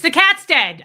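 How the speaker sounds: sudden loud, high blurt; blunt announcement. Retold or imitated. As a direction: blurt the bad news at full volume. Retells abruptly blurting bad news.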